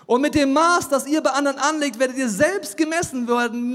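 high, loud preaching of scripture; loud, driving preaching; forceful preaching. Real, not acted. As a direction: preach the verse at full force.

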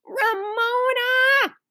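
high, long drawn-out scolding cry; horrified. A narrator's reading. Big sister sees Ramona show her scab, horrified. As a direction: stretch the name high in horror. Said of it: "Beezus was horrified".

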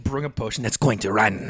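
lightly imitated wizard from a mythical land. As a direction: intone it like a mock prophecy. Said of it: "wizard from a mythical land".

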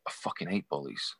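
low and quiet. Retold or imitated. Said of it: "he went".

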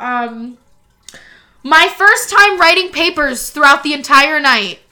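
ordinary talk

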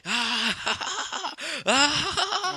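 high, loud possessed cackle; imitated crazed laugh. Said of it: "he was just laughing. He was just like".

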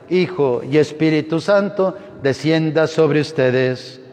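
normal talking voice

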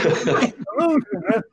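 laughing. Retold or imitated. Telling his wife why he's crying, laughing. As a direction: laugh at your own sorry excuse. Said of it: "I'm like".

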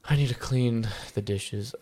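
dragging, slow reluctance; reluctant groan. Acted. Talking himself into washing up, mildly reluctant. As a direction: drag it out and end on a quiet "ugh". Acting out reluctance over a chore.

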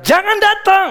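high, loud command to stop; commanding cry. Acted. Preacher voicing Jesus, a high loud call to stop.

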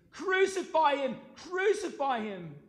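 high, loud, drawn-out shouts; crowd's cry. A narrator's reading. Priests and officers answer Pilate, crying out. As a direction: shout it out like a mob demanding death. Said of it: "they cried out".

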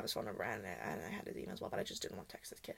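whispered guilty aside. Real, not acted. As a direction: drop low and hush it.

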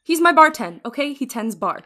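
rising rant, loud at the end; angry outburst. Acted. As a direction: tell off your mother, building to a yell. Player character yelling at mother.

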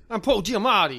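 half-hearted celebrity impersonation; badly faked actor impression; Paul Giamatti. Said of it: "not even what he sounds like".

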